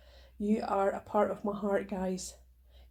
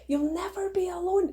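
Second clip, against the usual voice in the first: higher and brighter, leaning on alone; emphatic reassurance; 9 semitones higher, 3 dB louder than usual. Shown as a demonstration. Repeating her promise to listeners, higher and emphatic. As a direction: lift it up and land hard on alone.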